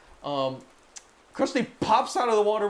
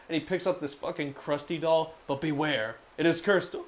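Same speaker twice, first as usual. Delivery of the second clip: ordinary talk, nothing marked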